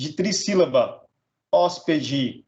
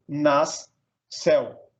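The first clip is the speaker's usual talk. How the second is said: "nas" and "céu" as two separate beats; split into syllables; much slower than usual. Shown as a demonstration. Teacher dividing nasceu, "nas, céu" syllable by syllable. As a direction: say "nas", pause, then "céu". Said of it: "separar um em cada sílaba".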